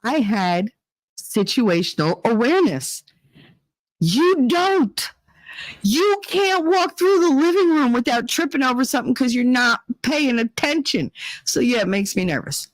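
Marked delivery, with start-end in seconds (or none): 4.0-11.1 high-pitched, emphatic telling-off; exasperated scolding. Retold or imitated. Mom telling kids they lack street smarts, exasperated scolding. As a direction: scold them in a raised, incredulous pitch.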